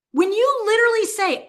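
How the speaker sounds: ordinary talk, nothing marked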